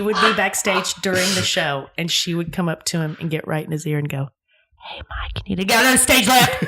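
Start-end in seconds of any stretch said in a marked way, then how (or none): 4.9-5.4 unvoiced hushed whisper. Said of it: "she could not whisper". 5.5-6.6 high loud hushed yell; failed whisper shout. Retold or imitated. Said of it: "she could not whisper".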